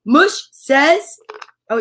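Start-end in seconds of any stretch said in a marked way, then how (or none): none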